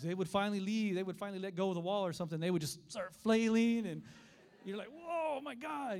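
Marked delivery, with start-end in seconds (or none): 4.9-6.0 high, startled exclamation; mock alarm. Preacher reliving kids flailing at him, alarmed. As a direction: react as if a swimmer is clawing at you.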